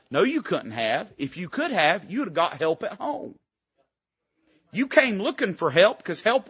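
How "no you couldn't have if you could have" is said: usual speaking voice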